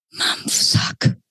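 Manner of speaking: unvoiced frightened breath of a name; barely voiced gasp of fear; breathed whisper. A narrator's reading. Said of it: "hauchte Lilly".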